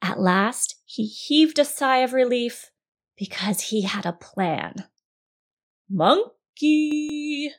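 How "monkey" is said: high, sing-song coaxing call; mock-gentle calling. A narrator's reading. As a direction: call out sweetly, stretching the last syllable. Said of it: "called out in the gentlest voice he could manage".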